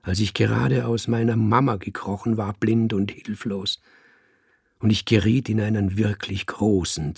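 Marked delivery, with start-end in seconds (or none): none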